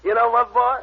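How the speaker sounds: very high, loud and euphoric, laughing; a drugged strongman thrilled he can fly; giddy and high. Acted.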